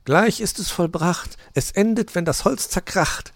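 high little hedgehog voice; lightly amused, high child voice; laughing child. A narrator's reading. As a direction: pipe it up with mild amusement. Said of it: "lachte Borstel".

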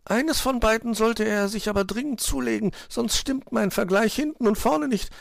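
high piping bird voice; insistent little bird. A narrator's reading. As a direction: chirp it earnestly, way up high.

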